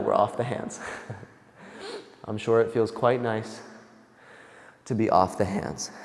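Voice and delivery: breathless, panting between words; out of breath. Real, not acted. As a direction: talk while winded from the workout. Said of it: "I am out of breath".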